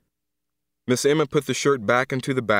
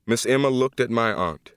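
plain reading voice

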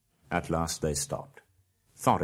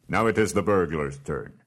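louder, firm dwarf leader; commanding dwarf king; 6 dB louder than usual. Acted. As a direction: hand the job to the burglar. Thorin gives Bilbo orders.

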